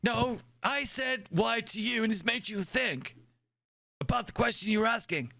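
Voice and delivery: put-on high, insistent old admiral; crotchety old commander explaining. Plays old admiral character.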